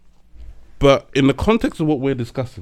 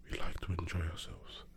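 near-whispered, much softer; very quiet; 9 semitones lower, 20 dB quieter than usual. Retold or imitated. Quoting mum on what women like, very quiet. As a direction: murmur it almost without voice. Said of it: "Say it louder".

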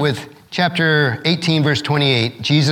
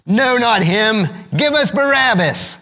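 higher, slow crowd cry, lightly raised; read-aloud shout; 6 semitones higher than usual. A narrator's reading. Crowd shouting back at Pilate, a mild read-aloud shout. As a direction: call it out as the crowd, not full force. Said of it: "They shouted back".

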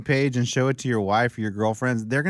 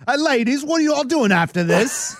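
put-on cheesy English accent, pitched up; mock English accent; 9 semitones higher, 3 dB louder than usual.

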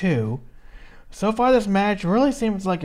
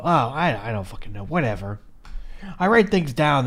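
ordinary delivery

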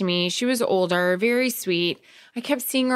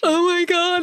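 high-pitched retold panic; hammed-up flustered "oh my God"; panicking; 10 semitones higher, 3 dB louder than usual. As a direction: gasp it out in a mock panic. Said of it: "so I'm panicking".

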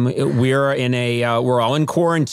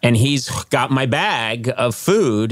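ordinary talk, nothing marked

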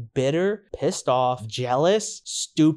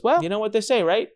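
offhand, slightly higher retold quote; imitating a breezy, nonchalant remark; 7 semitones higher than usual. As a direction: toss it off casually, as if quoting someone. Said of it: "really nonchalantly".

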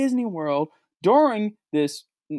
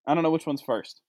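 usual speaking voice

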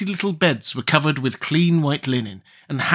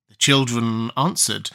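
ordinary delivery, nothing marked

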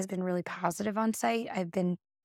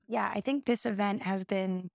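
normal talking voice

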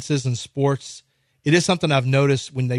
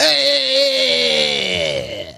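a higher Italian-style "Eh"; Italian exclamation; 8 semitones higher than usual. Pretend. Mock Italian commentator reacting to the miss. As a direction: throw out an Italian shrug of a sound. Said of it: "How would they have done it in Italy?".

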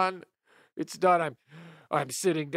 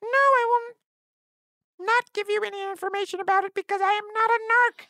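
high-pitched helium voice; 7 semitones higher than usual. Acted. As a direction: talk like you just inhaled helium. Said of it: "helium voice".

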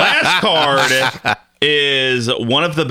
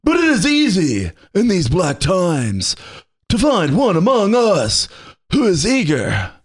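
Keanu Reeves; 3 semitones higher than usual. Acted. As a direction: draw the speech out like an actor impression. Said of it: "Keanu Reeves, correct? Of course".

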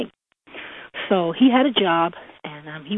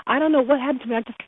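usual speaking voice

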